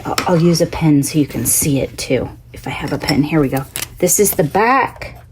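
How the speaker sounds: ordinary talk, nothing marked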